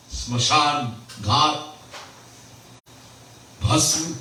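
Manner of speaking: normal talking voice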